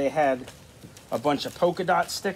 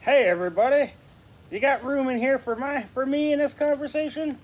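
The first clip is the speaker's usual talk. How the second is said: high, cheerful puppet character; 7 semitones higher than usual. Acted. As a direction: pitch it up for a chatty puppet. New puppet joins the conversation.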